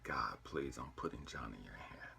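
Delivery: soft, low plea to God; surrendering prayer. Retold or imitated. Father handing his son over to God, praying low and softly. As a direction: let the prayer sink low and quiet. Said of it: "As I'm praying this".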